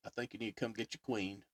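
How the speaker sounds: lightly voiced deep country man. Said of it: "Had that [name] voice".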